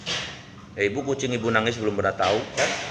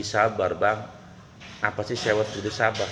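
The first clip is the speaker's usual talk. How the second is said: calm soothing; 5 semitones lower, 7 dB quieter than usual. Acted. Prophet calms angry hunter.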